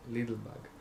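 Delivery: usual speaking voice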